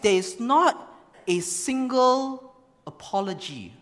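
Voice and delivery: ordinary talk, nothing marked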